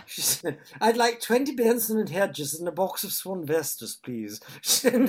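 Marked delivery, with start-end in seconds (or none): 0.8-4.4 "I'd like twenty Benson and Hedges" in a higher, louder mock-posh RP voice; put-on posh accent. Friend ordering cigarettes in a put-on posh RP accent. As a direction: order as if you'd been taught RP. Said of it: "tried it on with this".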